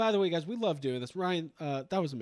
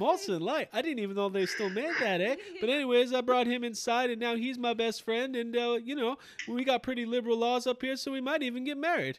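high, rambling Canadian buddy impression; Ryan on the phone; 7 semitones higher than usual. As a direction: keep the mock caller's yarn going up high. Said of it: "Ryan will call me on the phone".

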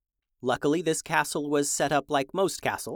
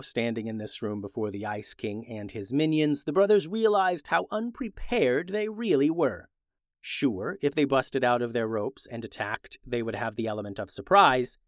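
usual speaking voice

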